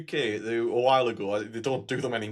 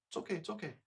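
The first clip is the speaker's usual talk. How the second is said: higher, very soft reassurance; softly placating a frightened parent; hushed soothing; 5 semitones higher, 12 dB quieter, much faster than usual. Retold or imitated. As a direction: soothe quietly while sneaking away. Still soothing mother while backing away.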